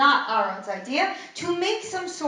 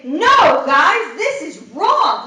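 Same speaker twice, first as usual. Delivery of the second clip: much louder, slow and stern; firm protest; 4 semitones higher, 8 dB louder, slower than usual. Pretend. A leader stopping the people from sin, loud and firm. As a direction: plant your feet and say it. Ideal strong protest voiced.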